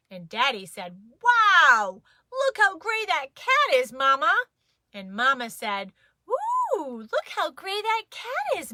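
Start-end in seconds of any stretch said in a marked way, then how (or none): 1.2-4.5 big, loud, higher Daddy wow; Daddy marvelling at the clouds, loud; Daddy's voice. A narrator's reading. 6.3-8.7 a high, lilting mom voice, a little hammed up; Mama cooing over the dog, pitched way up; Mama's voice. A narrator's reading.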